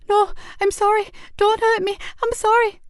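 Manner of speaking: put-on high, frightened child crying out in pain; fearful cry. Hurt boy begging his attacker, high frightened cry. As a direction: beg in terror like a hurt boy. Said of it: "cried out in fear and pain".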